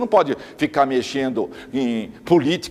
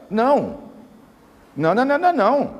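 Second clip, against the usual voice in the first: emphatic no; 7 semitones higher than usual. Real, not acted. Emphatic denial in lecture.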